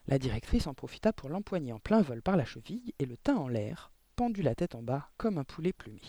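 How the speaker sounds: ordinary delivery, nothing marked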